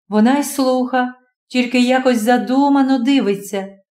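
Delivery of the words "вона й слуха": ordinary delivery, nothing marked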